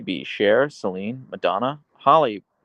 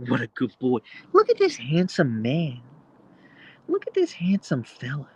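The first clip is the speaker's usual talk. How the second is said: dog talk; 7 semitones higher than usual. Real, not acted. Cooing to his dog on stream.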